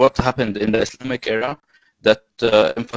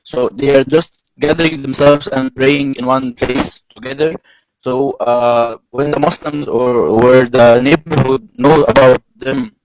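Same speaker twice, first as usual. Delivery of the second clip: strong, raised presentation voice; speaking louder; 3 semitones higher, 9 dB louder than usual. Real, not acted. Explaining to an audience why it happens, louder. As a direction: speak out to the whole room. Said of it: "I'll try to be louder".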